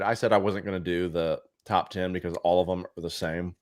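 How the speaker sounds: ordinary delivery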